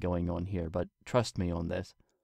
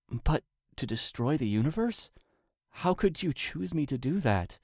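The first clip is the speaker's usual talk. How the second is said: put-on higher, disbelieving young mare; Twilight's pleading voice; 6 semitones higher than usual. A narrator's reading. Twilight questions the king in disbelief, higher. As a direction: ask it as if the weight is too much.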